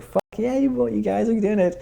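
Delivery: thrilled praise; excited cheer. Retold or imitated. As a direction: cheer them on with delight. Cheering couple on.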